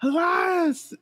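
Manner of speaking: high yelling call. Retold or imitated. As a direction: yell the name across the street. Jeff calling out for Elias.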